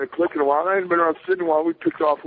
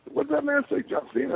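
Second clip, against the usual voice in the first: double take; 14 semitones higher, 5 dB quieter than usual. Retold or imitated.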